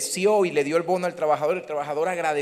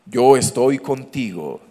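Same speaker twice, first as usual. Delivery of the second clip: lower, slow mock divine voice; TV voice of God; 5 semitones lower, 6 dB louder, slower than usual. Pastor mocking television God voice, low and slow. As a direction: ham up the movie God line slowly. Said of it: "Así son las voces de televisión".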